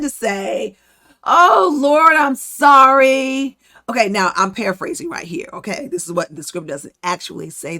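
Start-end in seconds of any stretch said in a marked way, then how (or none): 1.2-3.6 louder, tearful cry, slowing on sorry; crying plea. Retold or imitated. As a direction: break down begging forgiveness. Moses begs the Lord.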